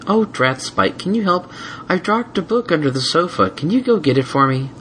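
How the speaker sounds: high, fake-helpless mare; sweet mare voice. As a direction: innocent request. Twilight voiced.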